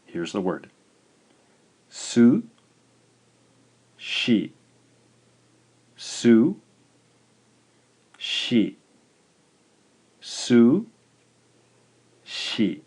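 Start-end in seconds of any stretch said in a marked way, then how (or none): 1.9-4.5 SU, long pause, SHI; syllable by syllable. Shown as a demonstration. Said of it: "Two syllables". 6.0-8.8 SU, long pause, SHI; syllable by syllable. Shown as a demonstration. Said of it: "Two syllables". 10.2-12.8 SU, long pause, SHI; syllable by syllable. Shown as a demonstration. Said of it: "Two syllables".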